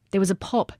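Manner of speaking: ordinary delivery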